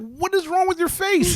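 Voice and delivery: baffled, mocking question; incredulous. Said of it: "And I'm like".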